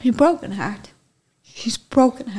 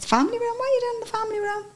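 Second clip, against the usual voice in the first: much higher, fast panicked disbelief; shocked disbelief; 3 dB quieter, much faster than usual. Retold or imitated. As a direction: blurt it out in alarmed disbelief. Shocked reply to Adam retold.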